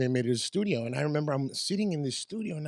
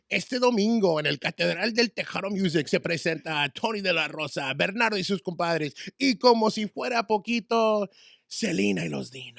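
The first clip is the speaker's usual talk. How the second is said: hyped Spanish radio promo, building higher; Kat Medina; 4 semitones higher than usual. Shown as a demonstration. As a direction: roll out a big Tejano concert announcement. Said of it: "Kat would always like".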